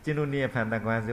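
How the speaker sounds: ordinary delivery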